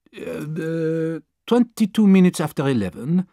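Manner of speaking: lightly voiced French manservant, polite; a hint of a deferential young Frenchman; French valet Passepartout. Valet answering his new master, lightly voiced polite Frenchman.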